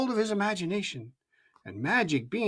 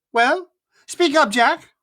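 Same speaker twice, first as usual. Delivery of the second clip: impatient mother; 7 semitones higher, 7 dB louder, slower than usual. A narrator's reading. Impatient mother demands answer.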